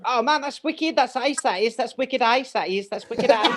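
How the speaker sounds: high-pitched mock English schoolkid; put-on English accent. Boy back from England showing off at school, put-on English accent. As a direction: imitate a kid showing off English slang and accent. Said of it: "I would talk with an accent".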